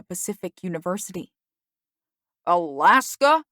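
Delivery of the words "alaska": loud, rising outraged question; furious demand. A narrator's reading. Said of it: "my father demanded furiously".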